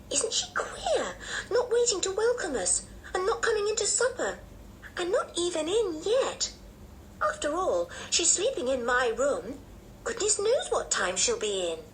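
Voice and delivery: put-on high-pitched child, puzzled and put out; indignant little girl. A narrator's reading. Anne puzzled by her absent cousin, high indignant child.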